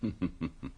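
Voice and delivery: a quick, quiet knowing chuckle; soft chuckle. Acted. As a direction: chuckle to yourself at the inspector's zeal. Poirot amused at inspector's plans.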